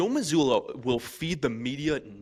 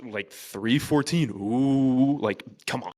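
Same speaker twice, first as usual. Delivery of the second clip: petty dramatic teasing; 3 semitones higher, 5 dB louder, much slower than usual. Retold or imitated. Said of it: "so petty and dramatic".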